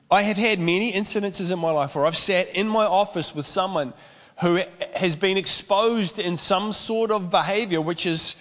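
ordinary talk

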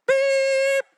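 high, loud bleep; censor bleep. Shown as a demonstration. Preacher bleeping the caller's words, high and loud. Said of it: "It's all censored".

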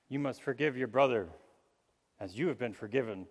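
ordinary delivery

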